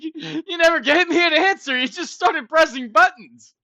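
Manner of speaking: a laughing, mock-outraged yell; laughing outburst. Real, not acted. Yelling at his co-host for not answering, laughing and loud.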